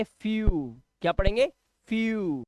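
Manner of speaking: फ्यू said as "fyoo", drawn out; yoo sound. Shown as a demonstration. Teacher modelling फ्यू for the class, long yoo sound. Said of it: "तो ये यू की आवाज आ रही है".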